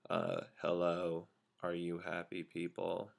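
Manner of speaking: low, slow, soft cartoon-dog drone; Droopy. Trainer recalling a grade-school cartoon dog, droopy impression. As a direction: say a cheery greeting with droopy gloom, completely against the words. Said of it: "droop face and say".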